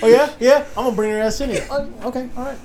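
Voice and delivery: smug principal. Retold or imitated. Mocking the principal accusing Dallas, smug laughing voice. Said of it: "motherfucker's acting all smug".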